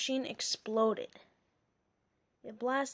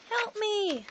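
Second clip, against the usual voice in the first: high call for help; 7 semitones higher than usual. A narrator's reading. Cornered professor calling May for help, pleading call. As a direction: plead up the hill. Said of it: "he called".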